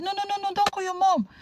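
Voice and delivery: high, urgent no-no-no pleading; panicked pleading. Retold or imitated. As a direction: beg the child to stop, alarmed. Woman begs child not tell.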